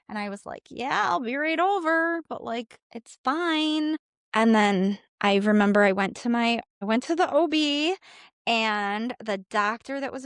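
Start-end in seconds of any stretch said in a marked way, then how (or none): 0.7-4.0 high, over-casual reassurance; putting on fine. Retold or imitated. Sobbing patient telling the clinic she'll come, putting on fine. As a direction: imitate insisting all is well, pitched up.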